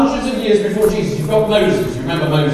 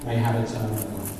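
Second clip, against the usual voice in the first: soft, low reverent reading; quiet Bible reading voice; scripture reading; 8 semitones lower, 6 dB quieter than usual. Acted. Reads scripture softly.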